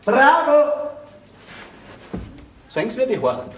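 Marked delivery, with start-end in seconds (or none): 0.1-0.7 calling out, rising on the repeat; second call pitched higher. Acted. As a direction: call to someone in the next room. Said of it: "Was schreien Sie denn so".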